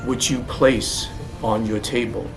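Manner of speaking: normal talking voice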